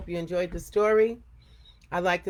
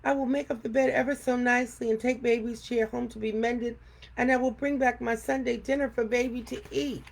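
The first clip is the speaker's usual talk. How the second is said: put-on little girl pleading; 6 semitones higher than usual. A narrator's reading. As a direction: promise sweetly to make amends. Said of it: "cried Afrotina".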